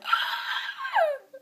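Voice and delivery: very high, thin mock squeal of fright; frightened squeal. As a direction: squeak out a re-enacted cry of panic. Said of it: "I'm like".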